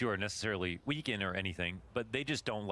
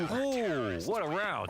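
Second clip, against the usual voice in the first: excited; 8 semitones higher, much slower than usual. Real, not acted. Caster reacting to round win.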